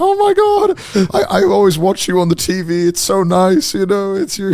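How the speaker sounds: high, slow starstruck fan voice; gushing mum. Retold or imitated. Mum telling Connor she watches him, high and gushing. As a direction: imitate a doting mum meeting a star. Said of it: "And she's like".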